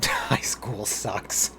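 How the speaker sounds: breathy laugh through the words, slowly; giggling as the line slips out. Real, not acted. Laughing at the dialogue option.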